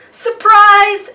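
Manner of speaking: high, loud group shout. Acted. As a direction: burst out to surprise her. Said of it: "shouted everyone".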